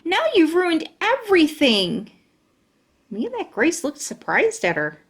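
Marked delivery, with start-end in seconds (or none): 0.1-2.1 high, sharp angry scolding; angry complaint. Acted. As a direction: blame them for ruining it. Lucille angry at rescuers.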